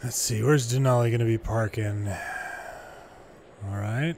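usual speaking voice

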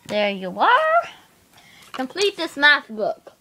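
ordinary delivery

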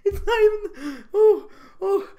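high, breathless giggly voice; trying to talk through the giggles; still laughing. Real, not acted. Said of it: "Whew, give me a minute".

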